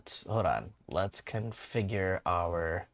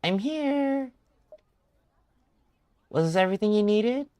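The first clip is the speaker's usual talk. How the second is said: lady's voice pitched way up; high-pitched character voice; 11 semitones higher, 3 dB louder than usual. Acted. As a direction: play her high and a little louder. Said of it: "pull a higher pitched voice".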